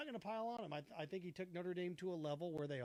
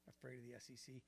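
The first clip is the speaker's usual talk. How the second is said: much softer, scornful echo of "Afraid of the S E C"; scoffing repeat; 6 semitones lower, 13 dB quieter than usual. Real, not acted. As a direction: mutter it back in disbelief. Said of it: "It's ridiculous".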